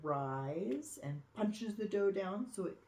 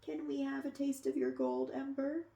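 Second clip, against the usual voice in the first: a hint of eager young dragons, pitched higher; lightly voiced eager dragons; 10 semitones higher than usual. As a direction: ask sweetly and hopefully. Visiting dragons beg for bread.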